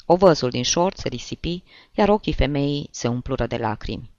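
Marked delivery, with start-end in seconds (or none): none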